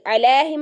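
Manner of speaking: "अलैहिम" with one open ae vowel, no glide; mispronounced "अलैहिम", middle vowel left unturned; wrong flattened vowel. Shown as a demonstration. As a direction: say "अलैहिम" as "alaehim", without the i glide. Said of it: "नहीं है ये".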